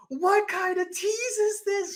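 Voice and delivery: hammed-up outraged guy; indignant Piper. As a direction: drag out the disbelief. Piper outraged at being shocked.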